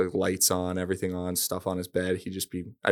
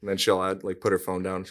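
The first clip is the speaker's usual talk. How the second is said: ordinary talk, nothing marked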